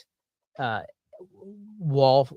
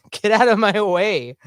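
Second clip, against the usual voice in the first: loud, higher mock-crotchety snap; mock grumpy dude; 7 semitones higher, 8 dB louder than usual. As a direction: play a get-off-my-lawn grouch, laughing it off. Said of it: "the first "get off my lawn" dude".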